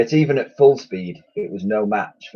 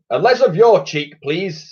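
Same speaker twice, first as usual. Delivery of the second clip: scolding admiral; 10 semitones higher, 5 dB louder than usual. A narrator's reading.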